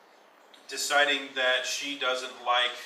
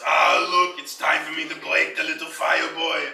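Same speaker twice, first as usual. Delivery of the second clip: loud gleeful villain voice; villain taunt; 3 semitones higher, 8 dB louder than usual. Acted. Game master voicing a villain taunting the player, loud and gleeful. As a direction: taunt the kid like a brute enforcer. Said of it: "and goes".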